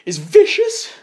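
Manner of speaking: high, tearful mock teacher voice; upset teacher. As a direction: mimic a teacher close to tears. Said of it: "with tears in her eyes".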